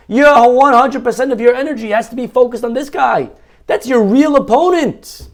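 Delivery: fiery sermon climax; preaching at full force. Acted. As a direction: hammer the point home to the crowd. Rabbi preaching at full pitch.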